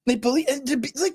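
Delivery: ordinary delivery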